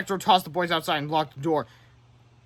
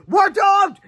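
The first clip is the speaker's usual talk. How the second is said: much higher, loud despairing cry; terrified wail; 8 dB louder than usual. Acted. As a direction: wail it like all is lost. Kid panicking at robot.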